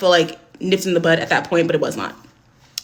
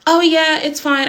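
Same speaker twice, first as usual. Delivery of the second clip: higher, bright cover-up brush-off; fake breezy; 7 semitones higher, 3 dB louder, slower than usual. To the concerned bus driver, casual cover-up, higher. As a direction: wave the worry away too lightly.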